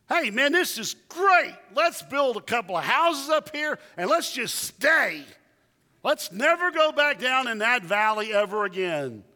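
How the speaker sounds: high, loud, thrilled mountaintop voice; eager Peter. Retold or imitated. Preacher voicing Peter on the mountain, excited and loud. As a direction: gush like Peter who never wants to leave.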